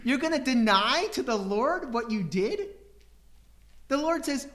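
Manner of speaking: ordinary delivery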